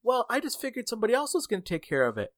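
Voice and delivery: high, quick imitation of an excuse; offhand excuse. Late manager makes excuses to worker, airy and quick. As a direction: brush it off as the manager.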